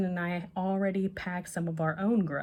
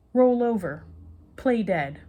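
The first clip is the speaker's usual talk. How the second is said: loud, higher commands to a puppy; dog commands; 4 semitones higher, 7 dB louder than usual. A narrator's reading. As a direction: bark the commands like training a dog.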